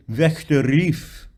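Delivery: "Wächter rief" slowly, with the R of "rief" rolled. Shown as a demonstration. Coach showing singer a German R, "rief" with a rolled R. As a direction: roll the R in "rief", unhurried. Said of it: "you can roll that a little bit".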